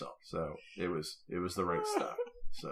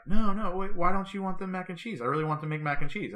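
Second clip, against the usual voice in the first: imitated wife, much higher pitch; wife protesting; 8 semitones higher than usual. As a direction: plead in a high imitation of the wife. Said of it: "And she's like".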